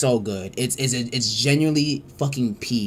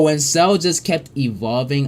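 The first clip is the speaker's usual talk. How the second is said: ordinary delivery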